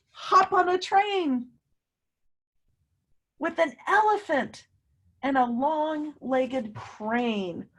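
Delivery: high, slow read-aloud voice; storybook reading. Acted. Teacher reading to children, slow and lilting. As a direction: savor each word for the kids. Reads story line dramatically.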